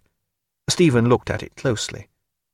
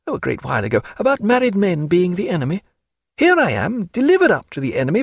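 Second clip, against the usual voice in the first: lightly raised woman's voice, teasing; a hint of a lively lady mocking her paint; Diana; 9 semitones higher than usual. A narrator's reading. Narrator voicing Diana.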